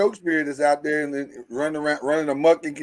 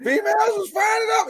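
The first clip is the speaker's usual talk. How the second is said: squealed, hammed-up dismay; high mock outcry. Host reacting to females fired up, high mock wail. Mock distress at a guest's story.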